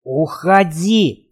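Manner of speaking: slow, forced out with effort; strained. Acted. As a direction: squeeze the word out, exhausted. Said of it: "через силу выдавил". (выдавлено через силу)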